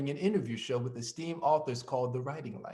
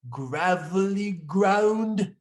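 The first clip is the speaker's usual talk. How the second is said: put-on slow, drawn-out Irish accent, higher and louder; 5 semitones higher, 5 dB louder, much slower than usual. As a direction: scrape out "gravelly ground" slowly in an Irish accent. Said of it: "in his Irish accent".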